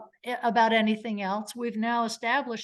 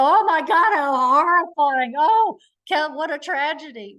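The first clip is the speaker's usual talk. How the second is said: loud, high, hammed-up horror; overdone argumentative horror; 6 semitones higher, 8 dB louder than usual. Shown as a demonstration. Lawyer showing how not to argue damages, overdone loud horror. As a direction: wail it theatrically, stretching "horrifying". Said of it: "waving your arms being argumentative".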